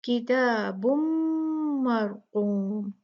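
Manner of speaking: किताबुम मरकूम, slowly, with a heavy क; measured tajweed reading; slow recitation. Shown as a demonstration. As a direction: drag out मरकूम with a full क. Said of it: "heavy पढ़ेंगे".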